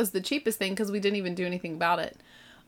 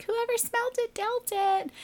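put-on much higher sing-song kid voice; teasing kid; 3 dB quieter than usual. One kid accusing the others, high teasing kid voice. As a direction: tease like a smug sibling.

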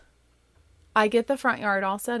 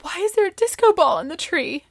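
lightly voiced child, higher and louder; mock the child's complaint, higher and louder; dismayed child; 11 semitones higher, 6 dB louder than usual. Daughter questioning the disco ball in the tree, mock dismay. Child objects to party decorations.

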